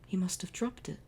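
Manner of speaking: unvoiced hushed line; whispered realization. Shown as a demonstration. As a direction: breathe it in wonder.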